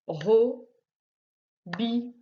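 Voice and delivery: "Robinet" slowly as ro, bi, net; three separated beats on "Robinet"; split into syllables. Shown as a demonstration. Teacher helping the pupil find the right syllable, split into syllables. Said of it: "à la bonne syllabe".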